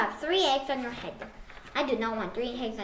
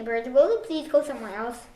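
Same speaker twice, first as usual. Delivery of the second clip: ordinary delivery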